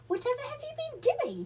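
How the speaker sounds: high exclaiming mother, a touch scolding; a lightly voiced startled mother; shocked mother. A narrator's reading. Mother to her children, shocked and scolding.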